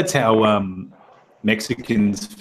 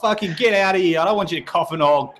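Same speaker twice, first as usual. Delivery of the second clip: higher, quickened hammed-up griping; mock exasperation; 6 semitones higher than usual. People griping at a crying kid in emergency, mock exasperated. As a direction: complain in a higher, fed-up put-on voice.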